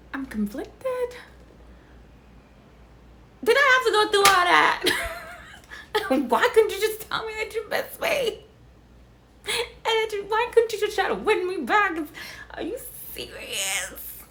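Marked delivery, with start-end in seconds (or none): 3.6-12.0 mock crying plea. Pleading with Tom in mock despair. 12.5-14.2 stunned whisper. Real, not acted. Disbelief at the twist.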